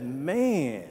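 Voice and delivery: imitated Boston townie, drawn-out words; slow mock-Boston delivery; Boston accent. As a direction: stretch it out like a Boston local. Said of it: "that's how they would say it".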